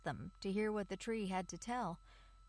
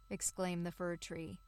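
usual speaking voice

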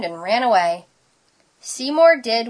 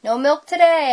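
higher, louder announcement; announcing; 6 semitones higher, 5 dB louder than usual. A narrator's reading. Teacher tells class the cupboard is stuck, announcing. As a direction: call out bad news to the room. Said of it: "announced Missus Wimbledimple".

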